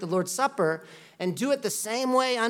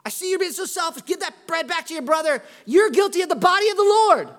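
higher, louder, hammed-up dad scolding a kid at dinner; mock scolding parent; 8 semitones higher, 7 dB louder than usual. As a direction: play an over-the-top father at the table. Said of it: "You're not like".

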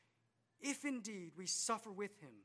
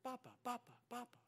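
soft babbled baby call, lightly voiced; baby calling Papa; 3 semitones higher, 7 dB quieter, faster than usual. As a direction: coo it like an infant reaching for dad. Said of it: "she would say".

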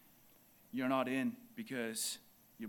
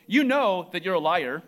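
hurled much louder, accusing; harsh accusation; 4 semitones higher, 13 dB louder than usual. Shown as a demonstration. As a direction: bark it at him like an accusation. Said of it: "I don't say it like that".

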